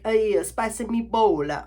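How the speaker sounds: slow, hammed-up cartoon Italian accent; mock a film's fake Italian accent; Mario Brothers Italian accent. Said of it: "Mario Brothers".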